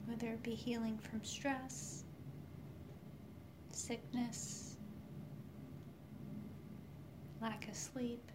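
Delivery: soft whispered meditation voice; right in your ear. Acted. Leads a guided healing meditation.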